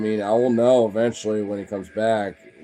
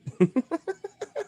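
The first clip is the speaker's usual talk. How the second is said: high, trailing giggle; laugh. Real, not acted. Laughs at the dog's look, amused giggling.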